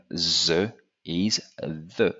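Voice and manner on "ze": THE said as "zuh", with a z; slow demo of THE with z for th; French-style "ze" for THE; French mispronunciation. Shown as a demonstration. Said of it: "they kind of pronounce it as".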